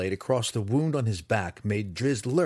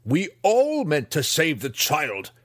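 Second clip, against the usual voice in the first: slow, louder, higher retort with stress on all; sharp retort; 7 semitones higher, 5 dB louder, slower than usual. Acted. Master retorting to Drizzt, slow and cutting. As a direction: throw his words back at him. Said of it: "retorted Hatchnet".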